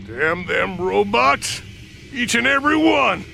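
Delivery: loud grumbling curse; cussing out the robots; angry. Acted.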